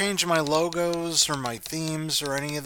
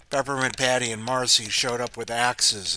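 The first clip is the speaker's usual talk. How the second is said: ordinary delivery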